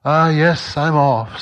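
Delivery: a flustered ghost backing away; nervous ghost. A narrator's reading.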